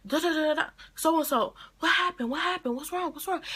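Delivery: high, frantic calling into the phone; panicked mother. Retold or imitated.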